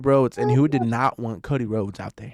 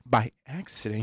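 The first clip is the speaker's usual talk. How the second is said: normal talking voice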